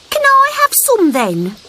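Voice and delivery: put-on high, hopeful child asking; little frog voice. A narrator's reading. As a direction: beg for a taste.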